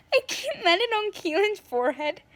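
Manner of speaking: normal talking voice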